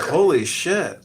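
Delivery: stunned at the outburst; shocked. Retold or imitated. Guest reacting to the uncle's threat, shocked. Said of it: "I was like".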